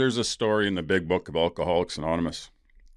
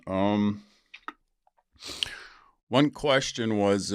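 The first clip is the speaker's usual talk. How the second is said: ordinary talk, nothing marked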